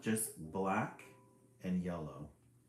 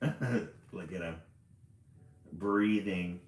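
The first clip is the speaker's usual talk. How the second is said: usual speaking voice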